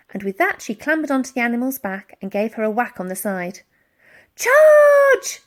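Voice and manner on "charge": very high, very loud shout; rally the charge; battle cry. A narrator's reading. Elsie urging Woolly at the policemen, battle cry. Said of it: "shouted Elsie".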